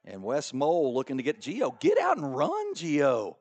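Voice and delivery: rising to a high, loud call on the run; excited play-by-play. Real, not acted. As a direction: call the breakaway live.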